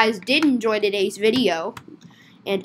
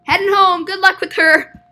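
higher, loud, quick send-off; called farewell; 6 semitones higher, 5 dB louder than usual. Acted. Leaving the officer with the customer, called out loudly. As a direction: call it out in one run.

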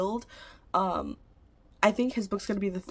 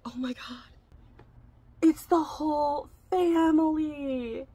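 awed gasp; 7 semitones higher, slower than usual. Real, not acted. Overwhelmed by the book box.

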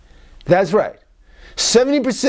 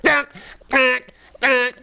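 higher mock zap sounds; electric prod zap noise; 6 semitones higher, slower than usual. Therapist imitating a cattle prod hitting someone, higher pitched. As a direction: mimic an electric prod jolting. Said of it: "it's like".

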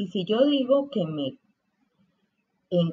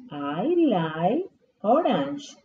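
slow, clear classroom model of I like strawberry; slow model sentence; much slower than usual. Shown as a demonstration. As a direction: say it slowly for the kids to copy. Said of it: "Diríamos". (despacio y claro, como modelo)

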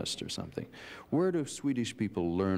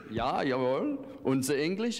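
put-on higher-pitched joking German accent; mock German accent; 7 semitones higher, slower than usual. Host playing along with the band's impression, mock German accent. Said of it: "can you do a German English accent?".

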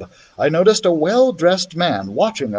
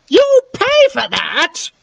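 high, slow threatening call after them; a voiced old woman's slow warning cry, much higher; heather woman calling out; 14 semitones higher, 3 dB louder, slower than usual. The heather woman calls after the fleeing children, high slow threat. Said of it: "the woman called after us".

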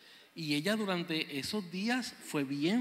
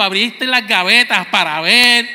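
ordinary talk, nothing marked

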